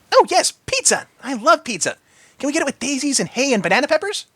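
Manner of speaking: high, delighted pony voice; giggly Pinky. A narrator's reading. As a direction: squeal about pizza. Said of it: "she giggled".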